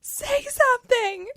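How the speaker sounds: high, drawn-out mock scream; screaming plea. Retelling a dream to a friend, screaming through a glass box. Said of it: "I was screaming".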